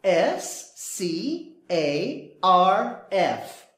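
spelling out. Shown as a demonstration. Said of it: "it's a scarf".

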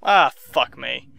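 low, slow groan of frustration; resigned cursing. Real, not acted. Gamer who lost unsaved progress, resigned low curse. Player swears after dying, then repeats louder.

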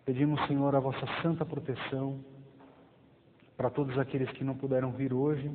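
ordinary delivery

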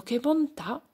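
exclaiming. A narrator's reading. The whole group relishing the juice, delighted.

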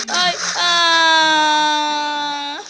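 a sudden fearful shout; scared cry. Real, not acted. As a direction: cry out in fright. Scared in the game. (grito assustado)

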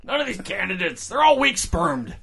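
high wailing exaggerated whine; whiny Doctor Klein. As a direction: wail like a frustrated doctor. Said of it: "That's how I'm imagining, Doctor Klein".

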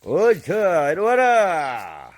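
slow, loud coaxing call; long loud come-here; drawn-out call. Real, not acted. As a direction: stretch it out to call the dog. Calling dog, drawn out.

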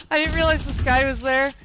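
loud laughing exclamation. Real, not acted. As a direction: burst out laughing. Laughing at a funny death.

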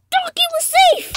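high, loud, drawn-out cry of relief; overjoyed shout. Real, not acted. Player seeing the donkey came back, overjoyed shout. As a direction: shout it slowly at the top of the voice.

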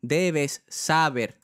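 "Debes saber" drawn out very slowly, each syllable stretched; stretched-out foreign-sounding "Debes saber"; mock wrong stress. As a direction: copy the singer's awkward, stretched "Debes saber". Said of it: "que no sabe de castellano".

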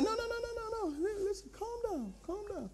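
high, hushed, soothing trainer; calm them down in a soft high voice; trainer's voice. Acted. Trainer telling the panicking pastor to calm down, high and hushed. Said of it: "He said".

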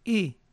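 "E" with a half-closed mouth. Shown as a demonstration. Teacher demos the vowel again, careful. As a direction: say "E" nearly closed. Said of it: "como una E con la boca semicerrada".

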